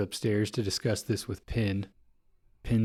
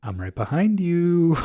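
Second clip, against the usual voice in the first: higher, louder spooky whisper-tone line; creepy doll voice; 7 semitones higher, 4 dB louder than usual. Retold or imitated. Host retells the doll's line, higher and louder. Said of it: "We hear Pin's voice saying".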